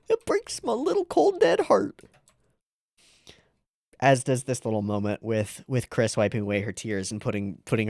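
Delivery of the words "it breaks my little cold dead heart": high, teary mock heartbreak; mock heartbroken. Real, not acted.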